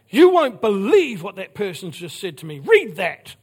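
loud, mock-outraged retelling of a complaint; indignant complainer. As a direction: act out someone scandalised by a text. Retelling indignant people showing a text.

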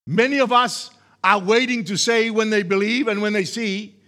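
full-force preaching. Real, not acted. Preacher urging listeners to say what they believe, emphatic preaching.

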